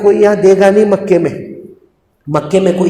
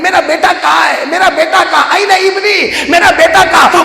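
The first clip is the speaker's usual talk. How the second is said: frantic mother calling; 10 semitones higher than usual. Acted. Mother running from home searching for her son, frantic cries. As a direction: call out desperately for the lost son.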